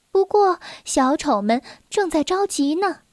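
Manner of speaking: plain reading voice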